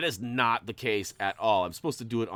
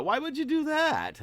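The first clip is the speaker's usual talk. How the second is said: much higher, mock-shocked question; mock incredulous; 5 dB louder than usual. Real, not acted. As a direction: tease the viewer playfully. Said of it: "no, I'm just kidding".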